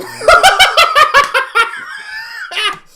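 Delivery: a very high, loud shriek of laughter. Real, not acted. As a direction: squeal with laughter. Laughs at the mating-season hair.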